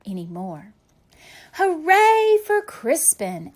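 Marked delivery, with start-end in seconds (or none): 1.5-3.5 loud, high, slow cheer; cheering shout. A narrator's reading. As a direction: celebrate him with a big shout. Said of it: "everyone shouted".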